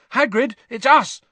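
loud high shout; Harry calling to Hagrid over barking. Acted. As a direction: shout through the door. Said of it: "Harry shouted".

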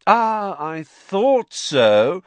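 high, loud, drawn-out know-it-all; self-satisfied announcement; smug Lockhart. A narrator's reading. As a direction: draw it out grandly, high and loud. Lockhart claiming he knew already.

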